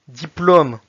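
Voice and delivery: "Diplôme" read loudly as Russian "diplom"; Russian pronunciation. Shown as a demonstration. Teacher reading words with learners, "Diplôme" in Russian. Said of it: "s'entraîner à lire les mots".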